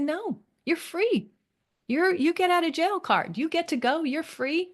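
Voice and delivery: high-pitched, insistent liberating reassurance; emphatic reassurance. Retold or imitated. Coach tells a burdened client she's free, emphatic reassurance. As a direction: lift the voice high to release them, stretching the first words.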